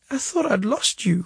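high crying voice; tearful. A narrator's reading. Son sobbing at his mother's bed, high and tearful. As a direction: choke it out through sobs.

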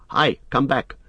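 understated narrated shout; calling after someone. A narrator's reading. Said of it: "shouted Sam".

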